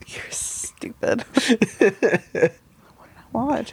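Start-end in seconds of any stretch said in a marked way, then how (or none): 0.1-1.2 slow whispered tease. Real, not acted. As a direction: breathe it as a jab. Teasing insult.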